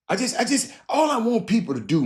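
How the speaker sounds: pleading, on the edge of crying; choked up. Real, not acted. Host pleading with listeners to treat people right, choked up. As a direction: voice cracking high with emotion, near tears.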